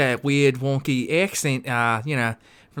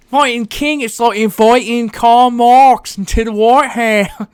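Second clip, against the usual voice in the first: much higher, louder Australian-flavoured Kennedy; exaggerated Australian-tinged JFK; 8 semitones higher, 9 dB louder than usual. Retrying the Kennedy quote, high and loud in an Australian accent. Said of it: "going into Australian".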